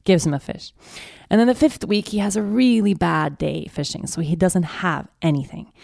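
ordinary talk

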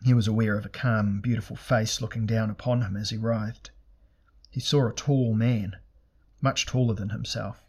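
usual speaking voice

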